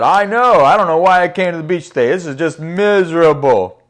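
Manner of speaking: loud, put-on whiny grumble; whining. Other older woman agrees with her friend at the beach, whining. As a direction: agree with loud, emphatic misery. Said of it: "They were whining".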